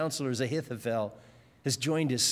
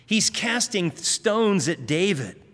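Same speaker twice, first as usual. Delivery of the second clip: usual speaking voice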